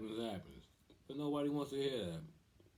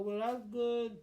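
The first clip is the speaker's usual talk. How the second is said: high-pitched, louder mock mom voice; girlfriend's mom; 12 semitones higher, 5 dB louder, slower than usual. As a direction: imitate the mom in a put-on falsetto. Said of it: "her mom just come out of nowhere".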